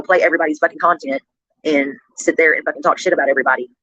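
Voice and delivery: ordinary delivery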